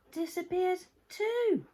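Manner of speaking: ordinary delivery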